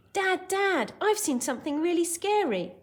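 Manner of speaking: put-on high, loud, alarmed boy's cry; Hogarth. Teacher acting Hogarth to Dad, high mock shout. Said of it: "because he's shouting it".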